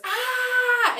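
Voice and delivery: a quick high yelled ah; short shout. Shown as a demonstration. Teacher showing kids how to shout it out, a short high yell. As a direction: let it out in one burst. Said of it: "Sometimes it helps to just".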